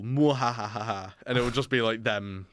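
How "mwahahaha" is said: slow hammed-up villain laugh; mock mad scientist cackle; evil cackle. Villain laugh in quoted meme.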